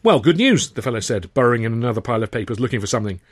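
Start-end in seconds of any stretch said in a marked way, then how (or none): 0.0-0.7 high, loud, exasperated boss; impatient manager. Acted. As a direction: hurry him along, very impatient.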